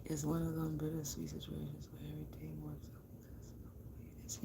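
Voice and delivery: hushed whisper, half to herself. Real, not acted. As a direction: drop to a breathy secret whisper. Sudden aside while reading cards.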